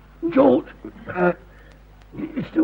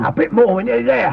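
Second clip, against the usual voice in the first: raised, higher voice under effort; strained encouragement; urging on; 6 semitones higher, 6 dB louder than usual. Acted. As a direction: urge them through the last heave. Urging on during a heave.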